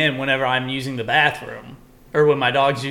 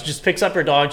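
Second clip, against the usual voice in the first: normal talking voice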